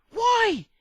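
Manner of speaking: loud, high cry of why; a high exasperated yell; exasperated shout. Real, not acted. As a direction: bellow the question at the sky. Exasperated rant outburst.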